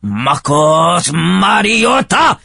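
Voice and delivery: hammed-up Japanese accent, high and drawn out; mock Japanese accent. Shown as a demonstration. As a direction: stretch the name in a caricatured Japanese accent. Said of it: "It's just saying something in a funny accent".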